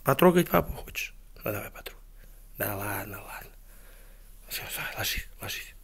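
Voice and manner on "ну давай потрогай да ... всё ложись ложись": very soft, near-whispered settling; gently quieten down for sleep; hushed bedtime murmur. Real, not acted. Parent lets child touch then lie down, near whisper.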